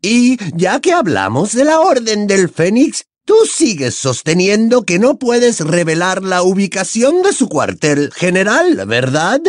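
high-pitched needling demand; Bellatrix, challenging. A narrator's reading. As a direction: press suspiciously in a high voice.

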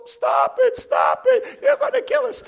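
high frantic shouts, half-laughing; terrified partner begging the driver to stop; panicked yelling. Retold or imitated. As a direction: shriek at the driver in terror. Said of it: "All I could hear was".

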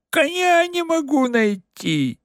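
high, strangled fake voice; muffled false voice. A narrator's reading. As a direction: answer in a smothered false voice. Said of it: "задушенным и фальшивым голосом". (сдавленный фальшивый голос)

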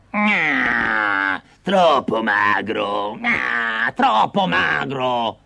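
put-on slow, wailing old witch; whining witch lament. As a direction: moan it out in drawn-out disappointment. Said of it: "si lamentò". (lamento piagnucoloso da strega)

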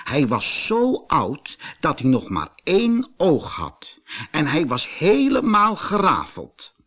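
usual speaking voice

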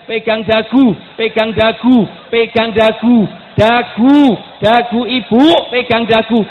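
high, very loud repeated order; insistent full-voice yelling; barked command. Real, not acted. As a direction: shout the instruction over and over at the crowd. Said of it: "sampai saya bentak-bentak".

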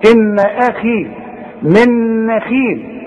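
أخي and نخيل drawn out with a light kha; slow Quranic recitation with a thin kha; slow recitation, thin khi. Shown as a demonstration. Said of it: "ممكن تبقى خي".

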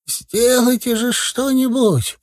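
high, drawn-out lament; wailing old man. A narrator's reading. Дед причитает, умоляя снять горшок, тягучим стоном. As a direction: wail like a suffering old man.

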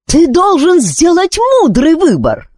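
high-pitched royal demand; exasperated, high, insistent order; angry cry. A narrator's reading. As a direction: stamp your foot and cry out. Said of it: "топнула ногой и крикнула".

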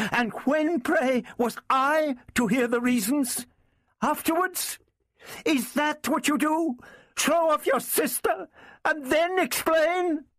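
very high, indignant woman; high-pitched, scornful outrage; agitated fury. A narrator's reading. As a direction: fire off accusing questions high in the voice. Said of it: "expressing her agitation and fury".

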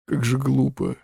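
slightly softened, helpless protest; understated feeble murmur; helpless babble. A narrator's reading. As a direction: falter as if at a loss. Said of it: "беспомощно пролепетал мистер Бенедикт".

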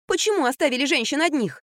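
high, mildly indignant question; exclamation. A narrator's reading. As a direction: exclaim reproachfully. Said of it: "воскликнула я". (высокий укоризненный возглас)

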